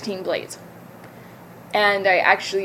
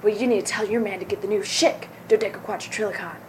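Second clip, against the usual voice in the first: lightly voiced ad pitchman, smooth and selling; a hint of a late-night infomercial host; mock TV commercial; 4 dB quieter than usual. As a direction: pitch razors to the ladies like a cheesy ad. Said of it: "it was like".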